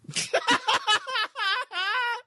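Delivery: high helpless laughter; high real laugh. Real, not acted. Laughing at best line.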